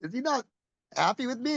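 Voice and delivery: high-pitched retold panic; mock scared. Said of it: "I got kinda scared".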